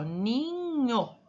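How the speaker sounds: Ninho stretched in one unbroken glide; drawn-out word. Shown as a demonstration. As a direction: draw out Ninho slowly without a break. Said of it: "vamos falar novamente". (Ninho arrastado, sem separar)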